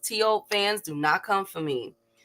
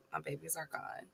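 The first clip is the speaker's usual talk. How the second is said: much softer, trailing away; fading mock-sad echo; 4 semitones lower, 22 dB quieter than usual. Host mourning his sold puppies, echoing it much softer. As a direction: let it die into a sad murmur. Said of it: "I'm sounding down".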